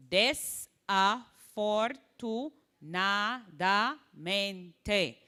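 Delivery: very slow, each syllable held separately. Shown as a demonstration. Teacher showing students how to slow down long words, syllable by syllable. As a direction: desafortunadamente broken into des-a-for-tu-na-da-men-te. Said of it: "syllable by syllable".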